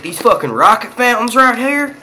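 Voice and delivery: exaggerated country twang, high; hammed-up country accent. Shown as a demonstration. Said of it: "Y'all hear my country accent".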